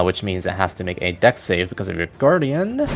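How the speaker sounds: ordinary talk, nothing marked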